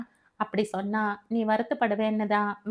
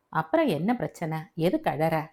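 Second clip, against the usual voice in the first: father asking; 5 semitones lower than usual. Acted. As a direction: lower fatherly voice, gently puzzled. Father asks crying daughter. (தாழ்ந்த குரலில் அப்பா கேட்கிறார்)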